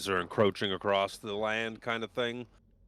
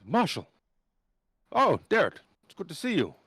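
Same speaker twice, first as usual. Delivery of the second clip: higher, worried noble woman; Marshal voice; 5 semitones higher, 4 dB louder than usual. Acted. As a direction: greet them with alarm.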